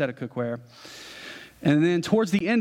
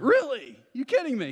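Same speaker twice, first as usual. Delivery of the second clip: higher, incredulous double take; surprised disbelief; 10 semitones higher than usual. Retold or imitated. Groom to the FedEx clerk, higher amazed disbelief. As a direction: jump up in pitch, can't believe it.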